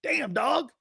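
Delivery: high-pitched mock disbelief; swooping high locker-room teasing voice; Jerome Brown. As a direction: imitate a teammate ribbing someone, pitch leaping up. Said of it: "Jerome looks over at me".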